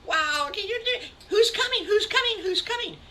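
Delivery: very high, loud who's coming, easing off; excited squeal. Acted. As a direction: beg to know like thrilled kids. Piglets ask about party guests.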